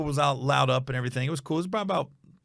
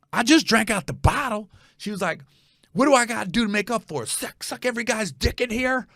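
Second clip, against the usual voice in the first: imitating the drunk woman; 9 semitones higher than usual. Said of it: "she goes".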